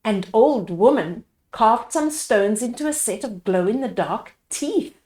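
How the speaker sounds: plain reading voice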